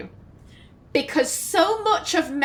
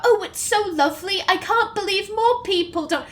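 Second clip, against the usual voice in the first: put-on higher, quick, lilting Welsh accent; attempted Welsh accent; 5 semitones higher than usual. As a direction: have a go at a sweet Welsh voice. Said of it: "my attempt at a Welsh accent".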